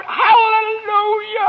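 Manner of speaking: slow, high ecstatic hallelujah; joyful cry. Acted. Father crying out hallelujah over his healed daughter. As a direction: stretch it out in pure relief.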